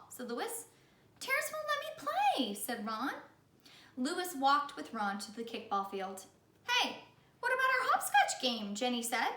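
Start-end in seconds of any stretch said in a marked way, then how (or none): 1.2-2.5 put-on high, tattling child voice; high child whine; whiny complaint. Ron complaining to Louis. 6.7-8.8 put-on high, loud girl's voice; indignant little girl; high protesting child voice; Jenny protesting.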